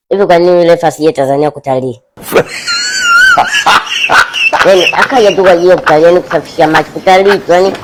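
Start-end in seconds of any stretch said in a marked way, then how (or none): 2.3-4.6 sudden shrill laugh at the joke. Real, not acted. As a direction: burst into a high, shrieking laugh.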